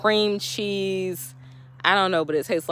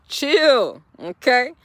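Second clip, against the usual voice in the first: chill, pitched up and punched louder; emphatic louder chill; 4 semitones higher, 5 dB louder, much slower than usual. Shown as a demonstration. To impatient viewers, a loud emphatic "chill". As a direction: hit "chill" hard to settle them down.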